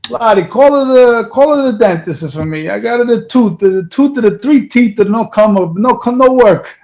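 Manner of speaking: imitating his Italian father in heavy broken English; Italian dad accent. Son imitating his Italian dad's accent on the phone. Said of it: "you nailed the accent too".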